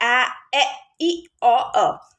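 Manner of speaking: आ, ए, ई, ओ, अ each short and separate; short vowel sounds. Shown as a demonstration. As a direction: recite आ, ए, ई, ओ, अ as isolated short vowels. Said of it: "short sound मतलब जो बच्चों को हम सिखाते हैं".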